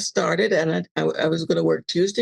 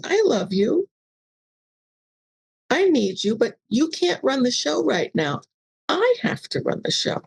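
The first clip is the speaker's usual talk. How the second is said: tender reassurance; 7 semitones higher than usual. Retold or imitated. Reassuring her ego firmly.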